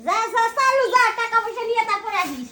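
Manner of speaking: high, loud shooing; angry dismissal. Acted. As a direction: chase him out of the house. Wife chases husband off to work.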